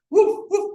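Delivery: high yappy woof woof imitation; dog barking. Dog in the car barking at the dog food poster, yappy barks. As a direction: bark like a small dog at the poster.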